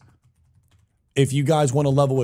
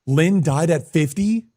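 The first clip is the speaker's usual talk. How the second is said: surprised; 4 semitones higher, 5 dB louder than usual. Real, not acted. Repeated shocked question.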